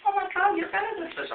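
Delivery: very high girl imitation; hammed-up high-pitched swooning; mock gushing. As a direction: gush in a squeaky put-on voice. Acted girl gushing in story.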